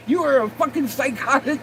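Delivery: high, laughing mock insult. Real, not acted. Scolding the absent mom, high laughing insult. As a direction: call her names through laughter.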